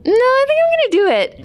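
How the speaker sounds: very high-pitched mock insistence; playfully stubborn. Director insisting to a doubting friend on her accent, high and stubborn. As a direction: squeak it out, digging in against objections.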